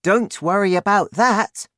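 put-on high, loud child's voice; confident girl. A narrator's reading. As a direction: brush off doubts in a high girl's voice. Narrator voices Sophie.

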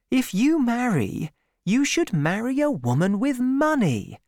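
a hint of a sensible young woman explaining; Bathsheba reasoning. Young woman tells Gabriel to marry money, lightly voiced.

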